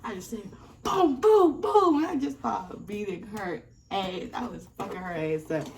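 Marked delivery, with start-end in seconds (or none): 0.8-2.0 loud, high punching noises; loud, high mock punch noises; punch sounds. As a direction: mimic throwing the punches. Narrator hitting back.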